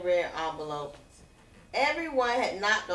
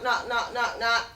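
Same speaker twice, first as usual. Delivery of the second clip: usual speaking voice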